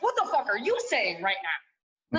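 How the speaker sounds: mock outraged daughter; indignant. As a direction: throw it right back at her. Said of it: "I was like".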